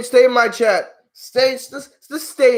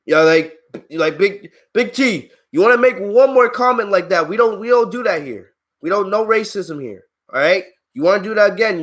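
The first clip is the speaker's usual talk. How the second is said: ordinary talk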